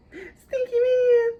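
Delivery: long, high squealed pet name. Real, not acted. Mom cooing a pet name to her baby.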